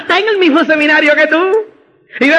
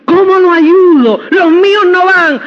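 mock lament, a touch of crying; whiny downline complaint; 4 semitones higher, 4 dB louder than usual. Distributor complaining to the speaker that his people won't go, mock tearful whine. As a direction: whine about your people like a hammed-up victim.

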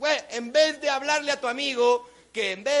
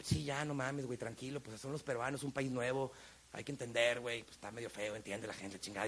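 low, soft self-talk under his breath; calming himself down quietly on the plane; muttering to himself; 11 semitones lower, 14 dB quieter than usual. Retold or imitated. Talking himself down on a plane, low and soft.

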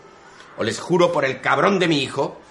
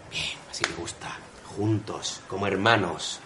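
much lower and softer, drained; exhausted mumble; 10 semitones lower, 10 dB quieter than usual. Acted. Sleepless sergeant sends the two men off, drained. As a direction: mutter it half asleep.

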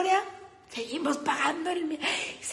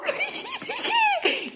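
shrieking laugh. Real, not acted.

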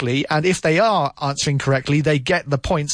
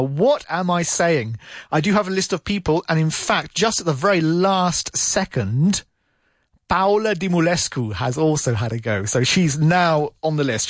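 normal talking voice

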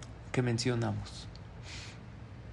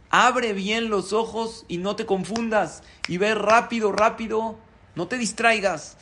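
high, urgent warning read from a letter; emphatic instruction; 6 semitones higher, 4 dB louder than usual. Acted. God's letter warning Jaim not to get distracted, emphatic instruction. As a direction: press each command home, pitched up.

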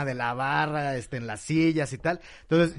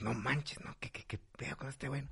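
muttering crowd; 2 semitones lower, 16 dB quieter than usual. Retold or imitated. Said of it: "Y todos".

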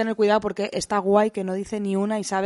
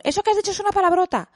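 put-on high child's voice, telling off; little girl scolding her parents; scolding daughter; 11 semitones higher than usual. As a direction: kid reproaching a parent. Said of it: "ella nos mete mucha caña con".

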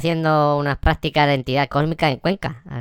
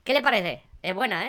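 helium voice; 5 semitones higher, 6 dB quieter than usual. Pretend. After inhaling helium.

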